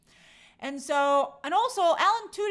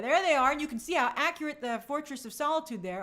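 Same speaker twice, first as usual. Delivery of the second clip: ordinary talk, nothing marked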